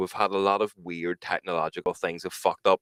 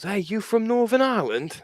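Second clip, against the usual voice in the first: high mock English voice; lightly mimicked English tourist asking the question; 14 semitones higher than usual. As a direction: play the English tourist with a higher pitch. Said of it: "English people walked up and goes".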